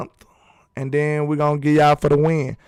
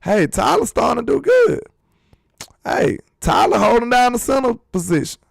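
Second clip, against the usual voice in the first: cheering call-out; 9 semitones higher than usual. Retold or imitated. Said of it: "we like".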